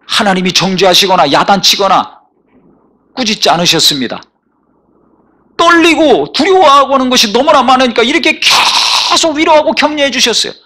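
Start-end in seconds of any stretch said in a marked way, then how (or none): 5.6-10.5 higher, building pulpit cry; mounting sermon fervour; preaching at full force. Acted. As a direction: climb toward the altar call. Rising sermon climax.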